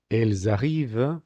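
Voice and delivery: ELLES ARRIVENT linked with a Z, "el-za-reev"; sounded liaison. Shown as a demonstration. Teacher reading the example text, ELLES ARRIVENT joined by a Z sound. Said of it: "la consonante S que suena con la vocal A".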